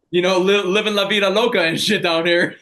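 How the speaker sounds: an excited, show-off brag; playful boast. Real, not acted. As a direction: sell the good life with a grin you can hear. Playfully boasting about life in Mexico.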